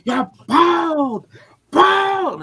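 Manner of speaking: high, loud mock shriek; imitated teacher scream. Teacher screaming in a pupil's face, mock shriek. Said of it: "wouldn't stop screaming".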